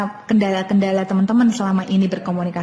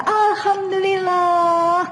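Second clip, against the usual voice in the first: much higher, slow, joyful, almost tearful Alhamdulillah; happy intonation; 11 semitones higher, much slower than usual. Shown as a demonstration. Teacher demonstrating to parents a mother's happy intonation, joyful. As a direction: show a mother overflowing with gratitude. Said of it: "itu intonasi bahagia".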